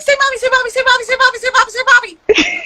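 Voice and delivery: high-pitched repetitive pleading chant; overexcited singsong mom voice; eager coaxing. Retold or imitated. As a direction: frantically prompt a toddler, voice way up. Coaxing child again, frantically.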